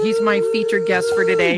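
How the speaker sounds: high, proud introduction; excited announcement. Real, not acted. Host proudly introduces his featured guest with a high excited lift. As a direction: announce him like the star of the show.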